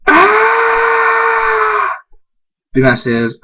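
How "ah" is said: long, high-pitched acted scream. A narrator's reading. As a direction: hold one high, sustained scream. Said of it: "Hunter screams".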